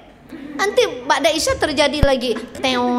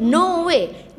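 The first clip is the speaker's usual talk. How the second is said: normal talking voice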